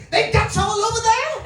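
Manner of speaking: high, loud, incredulous American lady; imitating astonished lady. Retold shocked American lady's question.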